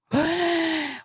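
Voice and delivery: a quick high shocked gasp; sharp gasp. Real, not acted. Gasping as the witness describes him hitting her. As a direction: suck in air at the news.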